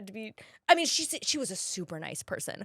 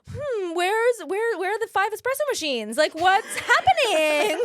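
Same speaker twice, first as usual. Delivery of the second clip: high, puzzled diva searching for espresso; mock bewilderment; 10 semitones higher, 5 dB louder than usual. Recalling missing network perks to podcast cohosts, mock bewilderment. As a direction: play spoiled and baffled. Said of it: "And I was like".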